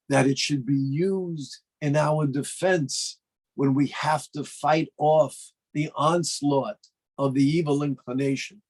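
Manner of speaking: normal talking voice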